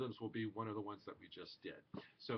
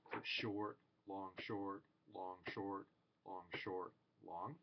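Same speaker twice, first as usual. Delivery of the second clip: short long said in rhythm, getting softer; rhythm recited; 3 semitones lower, 5 dB quieter, slower than usual. Shown as a demonstration. Teacher reciting a short-long figure for the class. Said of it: "very common rhythmic figure".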